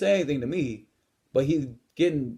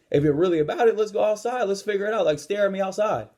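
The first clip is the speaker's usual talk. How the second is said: raised pitch, insistent dare; retold heated challenge; 7 semitones higher than usual. Gym-goer daring the bully to go outside, retold heated challenge. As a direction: call him out, worked up in the retelling.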